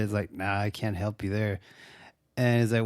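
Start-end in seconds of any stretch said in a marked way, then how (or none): 0.3-1.6 laid-back stoner dude. Retold or imitated. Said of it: "sounds like a stoner".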